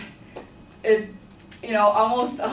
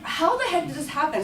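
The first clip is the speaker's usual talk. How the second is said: higher, baffled gasp of a question; panicking over the empty backpack, shocked and high; shocked disbelief; 6 semitones higher than usual. Retold or imitated. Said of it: "I was like".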